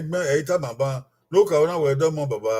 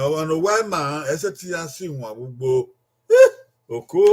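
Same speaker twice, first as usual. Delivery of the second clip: usual speaking voice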